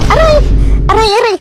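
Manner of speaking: high hammed-up pained cries; yelping surrender; mock yelp. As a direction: cry out in comic pain and give in. Yelping in pain, giving in.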